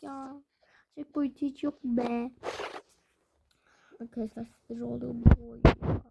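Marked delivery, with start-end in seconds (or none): none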